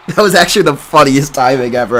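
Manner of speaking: cracking up over the words; laughing. Real, not acted. Streamer laughs to chat about his text timing.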